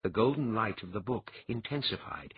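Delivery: plain reading voice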